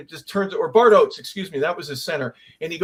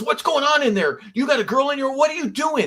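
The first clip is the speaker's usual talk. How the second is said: much higher, startled questioning; incredulous teammate; 9 semitones higher than usual. Retold or imitated. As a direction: burst in baffled, pitch jumping up.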